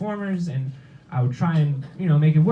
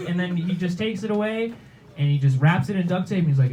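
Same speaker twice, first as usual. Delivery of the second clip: normal talking voice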